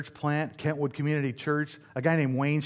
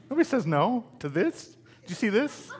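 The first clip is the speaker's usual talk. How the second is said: hammed-up arrogant young man, pitched much higher; mock cocky boast; 7 semitones higher than usual. Pastor imitating his cocky college self asking Karen out, hammed-up arrogance. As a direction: show off like you can't believe she refused. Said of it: "I'm like".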